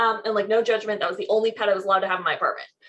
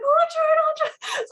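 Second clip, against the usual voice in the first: excited; faster than usual. Retold or imitated. Excited scene with ring box.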